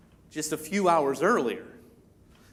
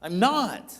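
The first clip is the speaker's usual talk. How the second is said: forceful, insistent denial; more emphatic; 3 semitones higher, 4 dB louder, slower than usual. Acted. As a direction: deny it with growing strength. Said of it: "more emphatic, a little more strength".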